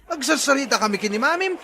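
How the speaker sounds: shooing call. Acted. Father calls to kid to leave them alone.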